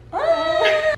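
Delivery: high-pitched mock wail; exaggerated high cry of dismay. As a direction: let out a squealed, hammed-up "ah". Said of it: "I was like".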